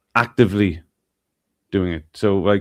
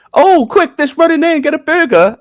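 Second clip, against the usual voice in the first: much higher, louder excited squeal; mock eager parent; 7 dB louder than usual. Asking the host what he'd do, in a high giddy mock parent voice. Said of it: "Do you go".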